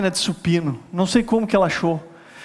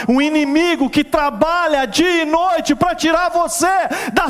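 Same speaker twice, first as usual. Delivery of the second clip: preaching at full force; 9 semitones higher than usual. Real, not acted. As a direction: rouse the congregation, building to a cry.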